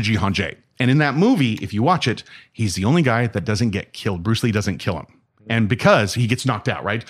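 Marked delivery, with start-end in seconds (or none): none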